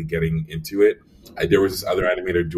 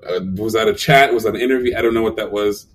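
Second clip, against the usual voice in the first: laughing disbelief; 5 semitones higher, 6 dB louder than usual. Real, not acted.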